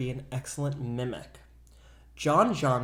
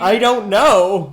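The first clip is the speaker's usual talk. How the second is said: slow, loud and up high; a loud, slow, half-laughing dodge; drawn-out loud I don't know; 6 semitones higher, 14 dB louder, slower than usual. Real, not acted. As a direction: stretch it out in mock alarm. Teasing after a risky joke.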